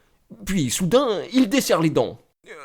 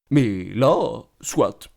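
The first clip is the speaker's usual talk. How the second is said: ordinary talk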